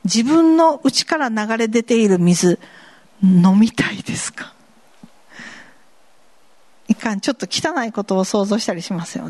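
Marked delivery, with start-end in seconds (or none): 3.2-4.5 hushed, breathy whisper; whispered question. Real, not acted. Preacher asking the congregation in a teasing whisper. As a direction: lean in and whisper it.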